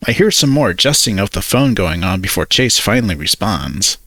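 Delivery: plain reading voice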